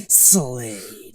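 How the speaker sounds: loud, drawn-out, melodramatic SLADE; Robin. Retold or imitated. Fan mocking how Robin says the name, overdramatic. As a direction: stretch the one word out with mock intensity. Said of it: "why you gotta say it like that?".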